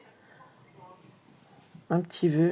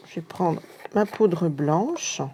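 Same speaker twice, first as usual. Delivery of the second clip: normal talking voice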